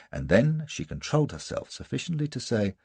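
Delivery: usual speaking voice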